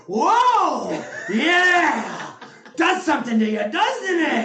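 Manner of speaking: hammed-up shouting, pitched high and loud; big swooping, stretched exclamations; Al Pacino impression. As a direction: bellow it like an over-the-top movie devil. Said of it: "followed by Al Pacino going".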